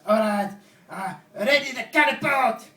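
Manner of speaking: loud, high, stretched-out order; mock battle command. French guy readying the catapult, mock commanding yell. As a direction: bellow it like a hammed-up commander.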